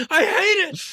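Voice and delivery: high, loud outburst; exasperated rant. Acted. Film-goer fed up with obvious jokes, high loud exasperation. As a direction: cry out your loathing. Ranting at laughing audiences.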